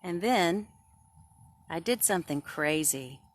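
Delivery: put-on loud, lively read-aloud to kids; storybook reading. Teacher reading a picture book to kids, lively and loud. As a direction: read the story big and bright for the class.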